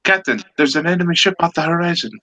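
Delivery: high, quick alarmed report; acted urgent sailor, pitched high; urgent lookout report. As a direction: rush out an urgent warning to the captain. Joke lookout reports enemy ship.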